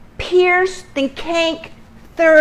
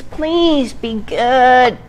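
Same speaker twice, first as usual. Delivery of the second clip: ordinary talk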